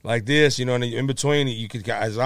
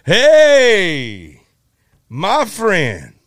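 high, loud, booming greeting to a friend; imitating B B King; 7 semitones higher, 6 dB louder, much slower than usual. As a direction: call out like a beaming old bluesman. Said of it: "and he goes".

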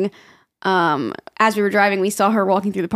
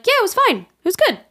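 high, light voice of breezy patients; mock-casual, pitched up; imitated breezy reassurance; 12 semitones higher than usual.

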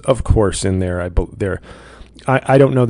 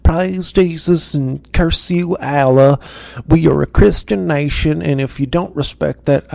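mock MAGA zealot; 3 semitones higher, 5 dB louder than usual. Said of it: "they're all walking around going".

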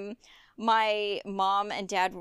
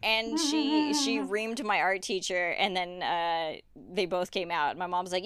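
normal talking voice

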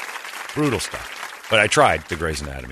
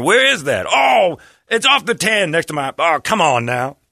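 mock shout; 9 semitones higher, 5 dB louder than usual. Acted shouted exchange.

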